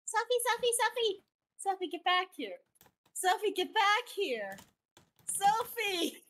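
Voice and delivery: high, urging pet call; calling the dog. Real, not acted. Owner calling wandering dog back, high-pitched.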